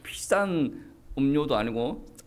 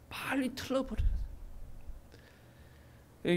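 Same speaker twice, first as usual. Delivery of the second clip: hushed, breathy, intimate urging; urgent whisper; 10 semitones higher, 8 dB quieter than usual. Real, not acted. Preacher whispering to the congregation to drop old words. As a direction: lean in and whisper the warning.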